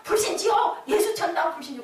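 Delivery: high, loud repeated slogan; acted martyr's outcry, starting at its highest; defiant street-preacher cry. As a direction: keep proclaiming it through the beating. Martyr keeps crying out when beaten.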